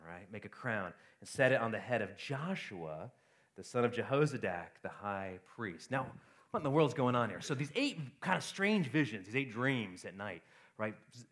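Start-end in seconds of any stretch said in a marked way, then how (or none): 0.3-5.9 scripture reading. Acted. Reading the scripture passage aloud.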